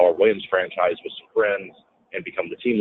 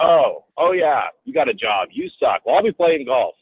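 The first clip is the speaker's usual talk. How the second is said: mocking the friend; 6 semitones higher than usual. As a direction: sneer it out like a cocky buddy. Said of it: "And he goes".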